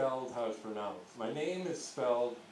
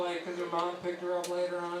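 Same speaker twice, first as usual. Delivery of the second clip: usual speaking voice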